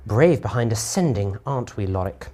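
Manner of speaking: usual speaking voice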